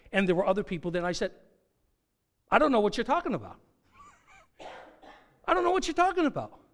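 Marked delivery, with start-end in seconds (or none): 2.5-6.6 quick, higher-pitched brush-off; acted denial, climbing higher the second time; hasty denial. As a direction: deny it in a rapid rush.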